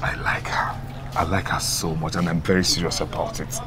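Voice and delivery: soft low confession; confiding whisper. Acted. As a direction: whisper your secret love. Confiding love to friend.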